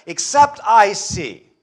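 slow, high, loud declaration; emphatic doubt. Acted. Thomas refusing to believe in the resurrection, emphatic. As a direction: lay down the condition forcefully.

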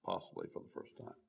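breathy hushed aside stressing "possibly"; whispered. Shown as a demonstration. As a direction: whisper "possibly for the first time", fast and hushed. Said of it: "Notice I said possibly".

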